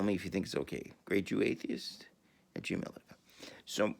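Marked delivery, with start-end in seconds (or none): none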